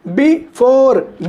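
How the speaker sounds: "before" in two parts, short bi, long four; stress on second syllable. Shown as a demonstration. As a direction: pause inside "before", then stretch four. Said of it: "बी नहीं खींचा".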